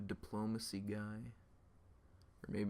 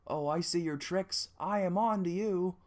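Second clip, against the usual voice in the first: accusing; 7 semitones higher, 6 dB louder than usual. Acted. Guard accusing.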